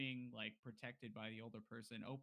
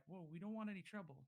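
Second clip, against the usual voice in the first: higher-pitched, wary backing off; nervous placating; 6 semitones higher than usual. Acted. Villagers backing away from a dangerous stranger, nervous. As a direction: voice a villager anxious to avoid trouble.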